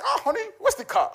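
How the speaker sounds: mock alarm at finding the car gone; high, laughing mock panic to his wife; startled question. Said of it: "I was like".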